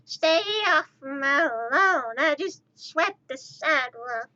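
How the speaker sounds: put-on slow, drawn-out old-timer holler; grumpy old person. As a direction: scold kids like a cranky old neighbor. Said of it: "grab my walker".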